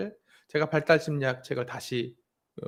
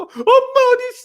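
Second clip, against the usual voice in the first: much higher, louder frightened cry; imitating a scared child; 11 dB louder than usual. Imitating the daughter too scared to open her eyes, crying out. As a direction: cry out like a terrified little girl. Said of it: "무섭다고".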